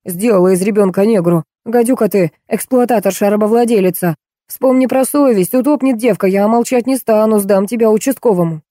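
ordinary delivery, nothing marked

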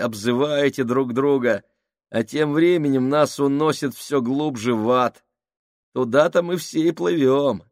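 выше и громче, с безумной ноткой; вещай как помешанный пророк гибели; безумный Крайчик. Acted. (Крайчик пугает Минхауса, громко и безумно)

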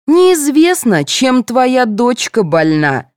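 loud high start, drawn-out retort; snapping back. A narrator's reading. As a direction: hit back testily, stretching the end. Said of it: "огрызнулась Галина".

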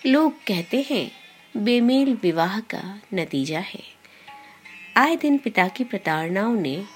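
plain reading voice

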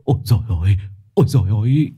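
acted trembling dread; panicked stammer. A narrator's reading. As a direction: moan in fright, barely getting it out. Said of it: "níu vai ông trưởng thôn lắp bắp". (lắp bắp run sợ)

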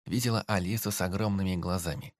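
plain reading voice

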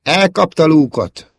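high, loud, drawn-out exclamation; alarmed cry. A narrator's reading. Princess sees Luke pulled under, alarmed cry. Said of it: "kiáltotta föl a hercegnő".

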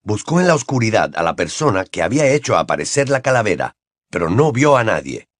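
ordinary delivery

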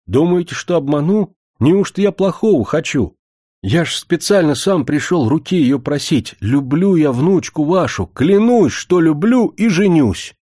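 high, fervent vow; impassioned protest. A narrator's reading. Suitor swearing to marry the granddaughter, fervently.

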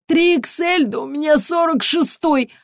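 high-pitched, indignant colonel; squawked, affronted objection; offended protest. A narrator's reading. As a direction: protest the size with wounded pride. Offended colonel disputes his size.

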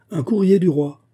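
high, loud herald's call; announcing cry. A narrator's reading. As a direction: call out the arrival across the room. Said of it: "cria le maître des cérémonies". (annonce criée, voix haute et forte)

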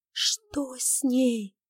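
very slow, voice breaking with fear; terrified breaking whisper. Acted. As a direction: barely get the words out, horrified. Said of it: "срывающимся голосом прошептала она".

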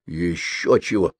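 slow, proud refusal; understated haughty exclamation, drawn out. A narrator's reading. Mitya rejecting the comparison, slow and mildly proud. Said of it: "гордо воскликнул Митя".

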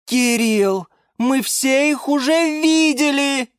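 shouting. A narrator's reading. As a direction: yell at him to stop. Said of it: "закричала Лиана Диана".

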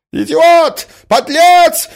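two very high, loud bursts; furious yelling. A narrator's reading. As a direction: scream each insult separately. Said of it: "кричала Марья Александровна". (яростный крик)

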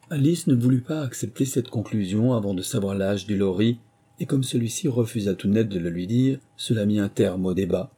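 ordinary delivery

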